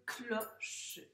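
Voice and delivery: "cloche" as clo, pause, che; syllable-by-syllable reading. Shown as a demonstration. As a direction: read "cloche" in two clear syllables. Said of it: "On l'entend bien quand on le lit". (« cloche » lu en deux syllabes, clo-che)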